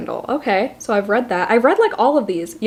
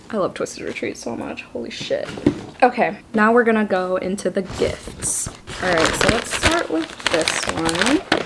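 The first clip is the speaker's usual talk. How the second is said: whispering; 3 dB quieter than usual. Real, not acted. Drops to whisper.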